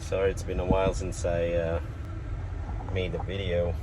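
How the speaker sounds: talking over a loud fan. Real, not acted. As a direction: speak up over fan noise. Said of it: "sorry about the fan".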